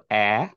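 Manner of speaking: অ্যা drawn out as the short a of apple; a teacher isolating the vowel অ্যা; isolated vowel sound. Shown as a demonstration. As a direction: sound out অ্যা on its own, slow and clear. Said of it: "একটার উচ্চারণ করলাম অ্যাপল".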